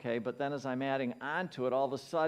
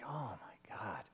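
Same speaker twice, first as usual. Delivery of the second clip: hushed, low exasperation; quiet, sinking disbelief; acted upset; 5 semitones lower, 11 dB quieter, slower than usual. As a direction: sigh it out softly in dismay. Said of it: "I'm getting upset".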